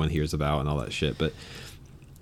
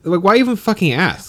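higher and louder, fed-up rhetorical question; exasperated; 12 semitones higher, 6 dB louder than usual. Real, not acted. Venting to a friend about store policy, exasperated and loud. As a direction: throw up your hands at the rule.

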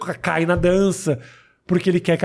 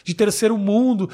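ordinary talk

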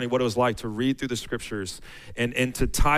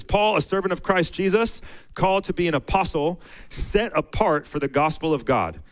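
raised, measured reading of the verses; scripture reading; 7 semitones higher than usual. Acted. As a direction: read it out like a pulpit lesson. Reads Romans aloud.

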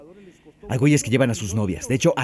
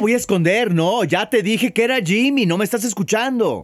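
exasperated; 8 semitones higher than usual. Acted. Defending himself in the quarrel.

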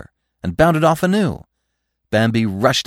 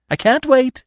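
high, louder playful taunt; teasing; 6 dB louder than usual. A narrator's reading. Feline racing away from Bambi, teasing. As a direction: tease him as you run off. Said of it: "teased Feline".

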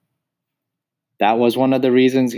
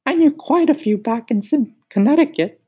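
very high, sing-song cricket asking who's there; much higher, lilting cricket voice; high musical cricket; 5 dB louder than usual. Acted. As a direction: squeak it up high like a tiny cricket. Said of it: "He had a high musical voice".

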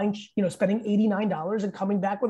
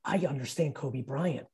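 softer, lower, slow musing; quiet realisation; 6 semitones lower, 7 dB quieter than usual. Retold or imitated. Remembering Kobe, quiet slow realisation. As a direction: muse it quietly to yourself. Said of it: "I started really being like".

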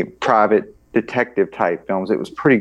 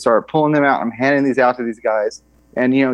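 ordinary talk, nothing marked

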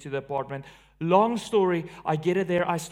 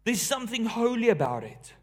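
raised, driving sermon voice; preaching with force; 4 semitones higher, 3 dB louder than usual. Acted. Pastor stirring up his church, full preaching voice. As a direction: preach it out to the congregation. Preacher building up the Elijah scene.

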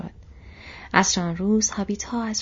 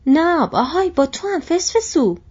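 much higher and louder call for Nob; shouting; 9 semitones higher, 9 dB louder than usual. Acted. Butterbur at the door shouting for Nob. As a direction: yell through the door for the servant. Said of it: "به طرف در رفت و فریاد زد".

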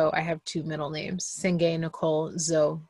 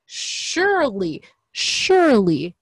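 "surely" drawn out slowly and loudly; escalating emphasis; 3 semitones higher, 8 dB louder, slower than usual. Real, not acted. Insisting these names can be said, long loud "surely". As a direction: stretch each "surely", louder on the last. Repeats the word, escalating each time.

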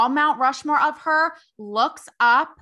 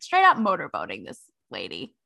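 ordinary talk

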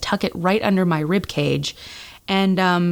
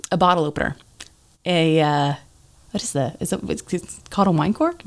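ordinary talk

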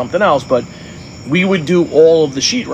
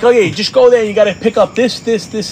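mock casual instructions, pitched up; breezy contractor; 7 semitones higher than usual. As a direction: imitate someone making it sound easy. Imitating contractor rattling off a list.

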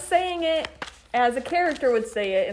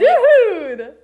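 character dude; 9 semitones higher, 8 dB louder than usual. Acted. Game of voiced dudes.

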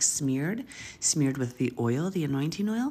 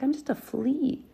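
high, slow self-belittling whine; small pitiful voice; 9 semitones higher, slower than usual. Retold or imitated. David mocking himself to Saul as a tiny flea. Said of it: "David's like".

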